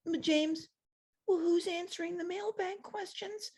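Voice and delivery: high, soft whiny nerd voice; pathetic wimp voice. Retold or imitated. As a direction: play a feeble, whimpering nerd. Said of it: "It's like a pathetic wimp".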